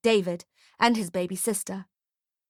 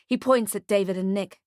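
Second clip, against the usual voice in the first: plain reading voice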